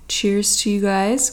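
usual speaking voice

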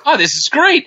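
high, put-on sarcastic "this is great"; sarcastic delight. Recalling his thought at the airport bar, in high sarcastic delight. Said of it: "I was like".

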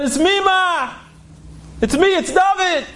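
high-pitched, eager call to mother; acted excited son announcing himself; emotional homecoming. Retold son returning home to mother.

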